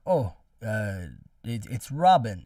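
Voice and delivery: stalling on "uh", then landing loud on "Robin"; halting, then blurting out "Robin"; hesitant pause. Retold or imitated. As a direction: imitate someone hesitating before giving his name. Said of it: "he pauses for a bit".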